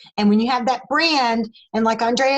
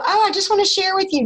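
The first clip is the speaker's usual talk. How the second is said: casual share; 8 semitones higher, faster than usual. Retold or imitated. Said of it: "you know".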